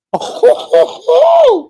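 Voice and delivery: high, loud hooting "oh-ho-ho"; long hooted laugh; loud whooping laugh. Real, not acted. Reacts to comment on the walk.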